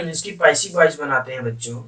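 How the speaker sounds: normal talking voice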